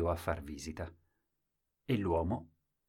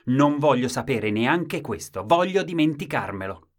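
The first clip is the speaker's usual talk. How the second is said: exclaimed refusal; 6 semitones higher, 8 dB louder than usual. Acted. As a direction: cry it out, refusing. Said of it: "ed esclamò".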